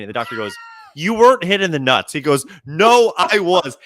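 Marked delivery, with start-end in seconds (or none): 1.0-2.1 louder, higher retold argument; a hint of a heated argument; arguing doctor. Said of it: "now on the ground arguing". 2.6-3.8 louder, higher and drawn out slowly; arguing back. Retold or imitated. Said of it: "now on the ground arguing".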